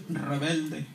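a soft low voice in the mind rebuking him; inner voice rebuking. Retold or imitated. God's voice in his mind scolding him, soft and low. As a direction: murmur it like a voice heard inside the head. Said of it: "en mi mente me decía".

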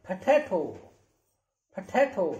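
पोटैटो as phuh-thay-tho; aspirated P and T. Shown as a demonstration. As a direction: puff of air on the P and Ts of पोटैटो. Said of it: "आप इसे फ बोलेंगे".

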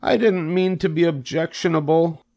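high, meek pig voice; apologetic Wilbur. Acted. Wilbur apologizes after being scolded.

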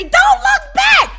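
high, loud, drawn-out mock yell; scary-movie warning. Horror-movie viewers warning someone who runs, loud mock yell. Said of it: "I know in the scary movies, they say".

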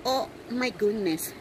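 ordinary talk, nothing marked

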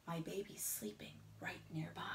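unvoiced whisper; hushed lullaby whisper. A narrator's reading. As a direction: whisper so the baby stays asleep. Hushing after elephant.

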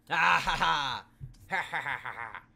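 loud high cackle; unhinged villain laugh. Acted. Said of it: "That's my evil laugh".

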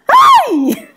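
high shocked cry that breaks into laughter; shocked yelp. Real, not acted. Vlogger reacting to the actress's claim of hard work, high shocked yelp and laugh. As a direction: yelp in disbelief, then laugh. Outburst of disbelief.

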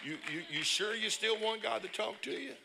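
loud, high, laughing taunt; laughing challenge. Real, not acted. Preacher teasing the congregation about giving, loud laughing challenge. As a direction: laugh it out as you dare them.

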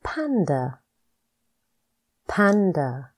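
each word spoken slowly and clearly, with a falling end; a teacher's slow, clear word-by-word drill; careful pronunciation drill. Shown as a demonstration. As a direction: model every word distinctly for the learner to repeat. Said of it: "Practice these words with me to pronounce the words properly".

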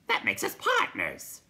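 loud creature declaration; hooting creature. A narrator's reading. Night Hob declares them partners, loud creature voice. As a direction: proclaim it loudly as a creature.

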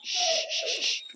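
rapid repeated shushes; quick string of sharp "shh" sounds; shushing. Real, not acted. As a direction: hush a noisy dog. Hushing the other dog.

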